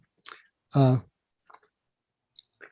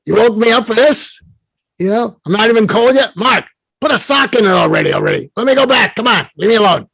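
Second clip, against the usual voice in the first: high, cranky Brooklyn complaint; Larry King, Brooklyn accent; 6 semitones higher, 4 dB louder than usual. Acted. Late mentor wants to be left alone, irritated Brooklyn accent. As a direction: grumble loudly upward in a put-on Brooklyn accent. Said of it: "And so Larry says".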